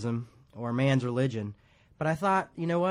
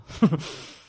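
laughing; 5 semitones higher, 6 dB louder than usual. Real, not acted. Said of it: "He's a Beatle now".

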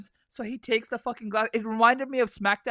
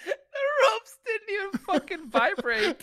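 much higher, slowed, breaking up with laughter; laughing; 10 semitones higher, slower than usual. Real, not acted. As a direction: squeal the line through helpless laughter. Laughing at the weak elbow.

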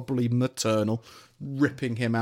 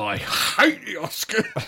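high, slow mocking echo of the Oscars line; 8 semitones higher, much slower than usual. Echoing his co-host in a high, slow mock voice. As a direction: parrot it back high and slow, teasing.

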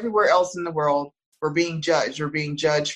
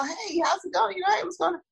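lightly voiced, higher breezy hello; casual boyfriend greeting; 12 semitones higher, 4 dB quieter, faster than usual. Imitating her boyfriend calling her with a higher, breezy hello. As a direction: toss off a higher, airy hey like nothing's wrong. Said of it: "So he'll call and he's like".

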